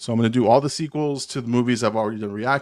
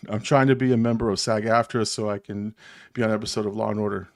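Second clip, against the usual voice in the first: ordinary delivery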